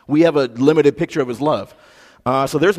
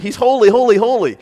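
higher, stressed holy each time; emphatic repetition; 8 semitones higher, 5 dB louder than usual. Shown as a demonstration. Preacher stressing God's holiness, emphatic and higher. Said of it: "This is very important".

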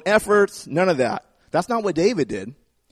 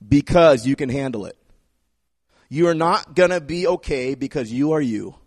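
normal talking voice

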